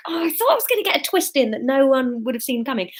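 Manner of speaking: ordinary delivery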